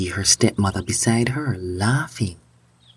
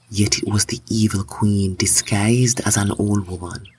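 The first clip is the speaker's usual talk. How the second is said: usual speaking voice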